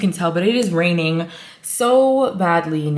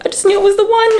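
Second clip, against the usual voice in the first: pitched way up, gushing; rising delight on "the one"; excited; 12 semitones higher, 4 dB louder, much faster than usual. Real, not acted. Repeat, higher and louder.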